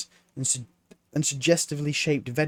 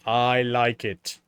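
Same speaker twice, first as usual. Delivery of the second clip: Death; 4 dB louder, slower than usual. A narrator's reading. Death telling Mort about the city, slow grave voice. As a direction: let Death savour each word.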